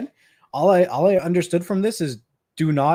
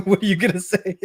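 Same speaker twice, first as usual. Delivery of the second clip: higher, words breaking into laughter; real laughing; 6 semitones higher, 3 dB louder than usual. Real, not acted. Dad telling viewers about his son's excuse, helplessly laughing. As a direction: crack up while asking it, pitched up.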